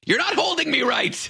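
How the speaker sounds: put-on high-pitched old-lady screech; mock shriek of complaint, pitch shooting up; imitated scream. Host imitates old lady berating her helpers, high mock scream. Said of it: "she'd scream to".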